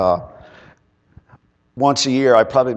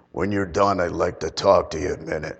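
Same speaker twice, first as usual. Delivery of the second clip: deep voice imitation; 5 semitones lower than usual. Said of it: "And that's how he talked".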